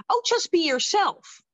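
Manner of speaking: high, drawn-out mock encouragement; singsong imitation of glib advice. Mocking the stock direction she got.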